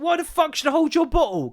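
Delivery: indignant retort. Retold or imitated. Twin refuses to hold brother's bottle, indignant. Twin snaps back at request.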